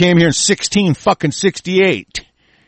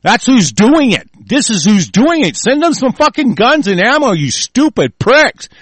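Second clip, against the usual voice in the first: higher, punched-out accusation; angry taunt; 5 semitones higher, 4 dB louder than usual. Real, not acted. Host blaming and taunting gun donors, heated. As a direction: drive each phrase home with scorn.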